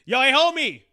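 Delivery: loud, high "yo, hey, homie" shout; loud call-out. Pretend. Man calling out a guy who skipped handwashing, loud. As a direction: call out across the store to shame him. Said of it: "I have a loud voice".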